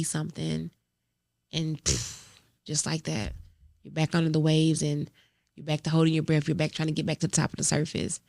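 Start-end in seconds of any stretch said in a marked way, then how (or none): none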